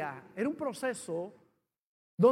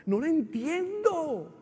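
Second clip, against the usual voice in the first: high, loud reenacted outcry; anguished protest; 6 semitones higher, 4 dB louder than usual. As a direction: cry out in hurt disbelief, voice climbing. Relives his anguished protest to God.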